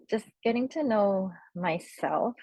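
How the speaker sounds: ordinary talk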